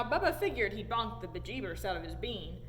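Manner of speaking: ordinary delivery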